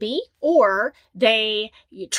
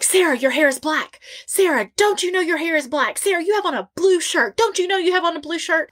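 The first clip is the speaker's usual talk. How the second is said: mock know-it-all; 5 semitones higher than usual. Imagined person stating obvious.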